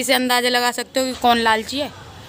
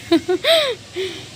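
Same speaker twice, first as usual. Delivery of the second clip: real laugh; 7 semitones higher than usual. Real, not acted. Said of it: "मुझे हंसी बहुत आ रही है".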